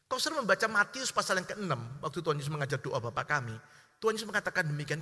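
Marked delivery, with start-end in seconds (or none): none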